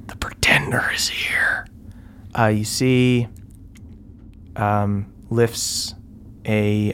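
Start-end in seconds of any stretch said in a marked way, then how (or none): 0.1-1.7 whispering. Acted.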